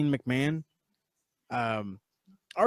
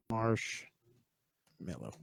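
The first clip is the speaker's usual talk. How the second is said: drawn out very slowly, much softer; slow typing murmur; 4 semitones lower, 11 dB quieter, much slower than usual. Real, not acted. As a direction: spell it out slowly under your breath as you type.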